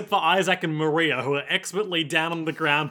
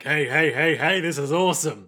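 bouncy, louder run of heys; mock gleeful excitement; 4 dB louder than usual. Lad skips down the hall, gleefully excited. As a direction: chant the heys as if skipping along.

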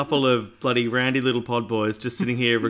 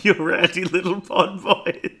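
laughing; 6 semitones higher, 4 dB louder than usual. Acted. Imagined shirt slogan, laughing.

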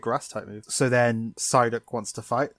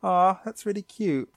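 mock aww; 7 semitones higher than usual. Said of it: "I'm like".

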